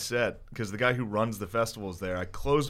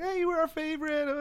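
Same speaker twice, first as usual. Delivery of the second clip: much higher, mock gushing young woman; gushing fan girl. Retelling fans praising him after the show, high gushing mimic. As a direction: squeal it like an excited fan.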